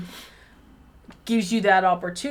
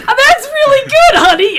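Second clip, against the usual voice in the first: high, loud, sugary mock compliment; hammed-up high gushing praise; 11 dB louder than usual. Co-host mocking a partner's praise, hammed-up high and loud. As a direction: gush it out sarcastically, pitched way up.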